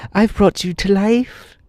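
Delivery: high-pitched Tip, drawn out; high slow boy. A narrator's reading. Tip answering the puzzled horse, slow high voice.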